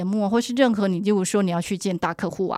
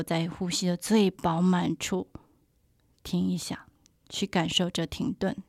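whispering. Shown as a demonstration. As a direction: whisper it to keep them still. Guided breathing, pause at full breath. (轻声耳语)

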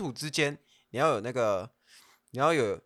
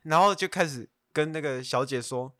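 ordinary talk

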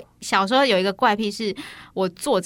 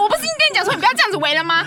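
louder, higher, fast scolding, laughing through it; mock angry brother; 10 semitones higher, 5 dB louder, faster than usual. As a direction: play the annoyed big brother, hammed up. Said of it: "他就整个大生气". (模仿哥哥半笑着大声骂)

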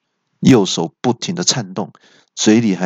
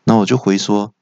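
ordinary delivery

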